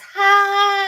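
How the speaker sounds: reading a viewer's hi in a sing-song voice. Acted. Reads out a viewer's greeting.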